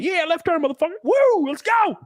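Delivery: high, hyped cheer; joining in the joke. Retold or imitated. NASCAR fan embracing the teasing with a high excited whoop. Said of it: "I'll join in".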